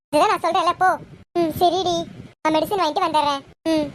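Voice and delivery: ordinary talk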